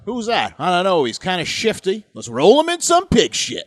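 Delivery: hammed-up hillbilly, building loud; backwoods local voice.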